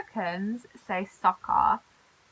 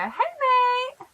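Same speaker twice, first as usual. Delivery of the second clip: much higher, bright "Hey, mate"; cheery greeting; 4 dB louder than usual. Shown as a demonstration. Showing viewers how she greets friends, pitched up high. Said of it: "I go".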